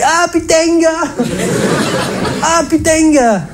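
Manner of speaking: put-on hearty southern French accent; Provençal accent. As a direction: sing it out in a broad Provençal accent. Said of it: "avec sa bonne trompe méridionale".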